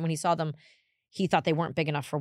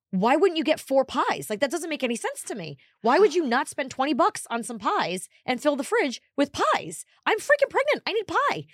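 higher and louder, building exasperated rant; 8 semitones higher, 3 dB louder than usual. Retold or imitated. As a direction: still indignant years later. Reliving the anger.

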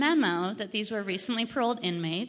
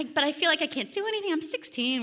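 ordinary delivery

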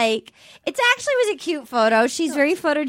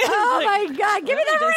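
mock desperate; 7 semitones higher than usual. Playing along with the ring-in-the-cake bit, mock desperate. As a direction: jump way up in pitch, hamming up the desperation.

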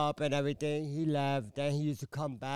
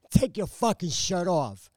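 louder, higher, forceful re-enacted order; retold angry command; 5 semitones higher, 5 dB louder than usual. Woman ordering her cheating man to strip, retold angry command. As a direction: order him about like you mean it.

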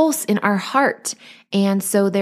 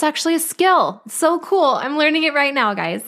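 excited; 8 semitones higher than usual. Real, not acted. Thrilled about learning a skill.

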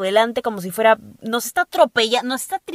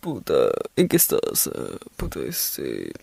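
husky voice-note guy; 6 semitones lower than usual. Retold or imitated. Host parodying men sending husky voice notes, mock deep voice. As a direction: drop way down and fake a husky come-on. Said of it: "pone la voz ronca solo para mandar audios".

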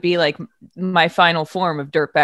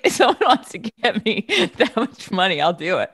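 higher voice broken by real laughter; amused, giggling as you say it; laughing while talking; 3 semitones higher than usual. Real, not acted. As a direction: laugh through the words. Laughs at the huge cash offer.

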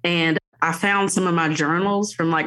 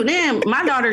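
ordinary talk

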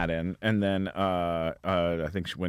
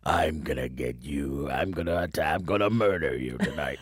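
theatrical villain menace; 7 semitones lower than usual. Shown as a demonstration. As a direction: growl the threat like a movie baddie. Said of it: "a voice of a bad guy".

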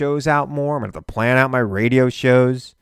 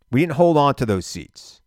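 normal talking voice

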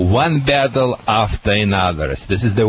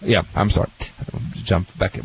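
normal talking voice

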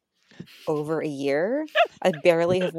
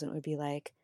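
normal talking voice

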